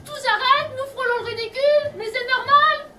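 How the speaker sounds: high-pitched, outraged challenge; indignant tirade. Acted. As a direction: throw the word back in outrage. Director quarrels over failed gunshot. (tirade indignée, voix montée)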